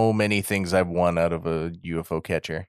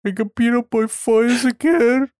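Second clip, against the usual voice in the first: high, loud whiny blubbering; exaggerated tearful whine, pitched way up; mock crying; 5 dB louder than usual. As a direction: wail it like a crybaby, hammed up. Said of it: "Takuma's crying".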